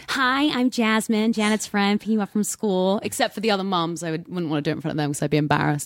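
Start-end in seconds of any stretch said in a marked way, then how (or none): 0.1-3.0 drawn-out put-on American friend character; Jasmine. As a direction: slowly introduce yourself as a made-up American babysitter. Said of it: "this Jasmine character".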